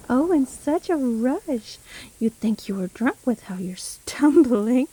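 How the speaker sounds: loud, drawn-out gloating; relishing taunt, stretched words; mocking witch. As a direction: jeer at their clumsy hurry. Witch mocks fleeing victim.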